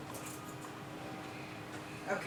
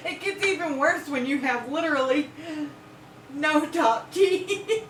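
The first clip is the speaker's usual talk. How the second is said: laughing; 6 semitones higher than usual. Real, not acted. Laughing at her toothless speech.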